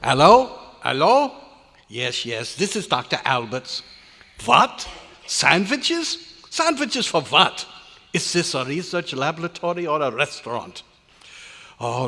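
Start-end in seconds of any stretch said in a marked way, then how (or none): 0.0-1.3 loud phone hello. Acted. Answering phone loudly. 4.4-10.8 put-on high, loud German-accented indignation; exasperated outburst. German scientist on the phone, outraged about sandwiches.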